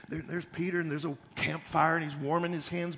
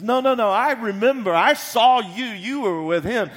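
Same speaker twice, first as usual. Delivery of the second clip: louder, higher, slowing at the end; insistent accusation; 6 semitones higher, 11 dB louder than usual. Acted. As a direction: insist she saw him. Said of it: "she comes back again and says".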